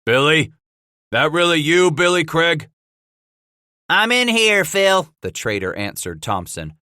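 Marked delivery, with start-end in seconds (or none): 0.0-2.7 loud, high calling out; hollered question. Acted. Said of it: "a voice that hollered out". 3.9-5.1 high, loud reply; shouted answer. Acted. Traitor shouting over the wall.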